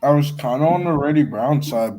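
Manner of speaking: ordinary talk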